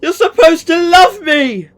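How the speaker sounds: a son's desperate accusation; hurt, angry shout. Acted. Son shouting back at mother.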